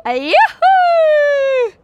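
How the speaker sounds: very high, very loud squealing whoop; the witch squeals as the beach comes into view; shrill yoohoo. A narrator's reading. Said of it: "gilde heks Fiona opnieuw".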